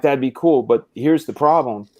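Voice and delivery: ordinary delivery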